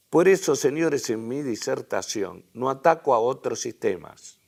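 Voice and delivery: formal public-address reading; reading a speech aloud. Acted. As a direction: read the statesman's address out to the hall.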